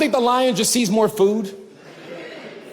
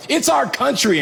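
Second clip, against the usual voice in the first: ordinary talk, nothing marked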